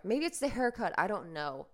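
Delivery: usual speaking voice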